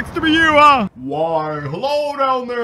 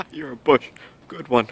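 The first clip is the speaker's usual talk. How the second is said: ordinary delivery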